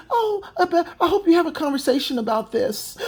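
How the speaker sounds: mocking imitation of a prim woman; mock prim lady. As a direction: sneer at her as a fake gentle lady. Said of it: "she tries to play non-threatening".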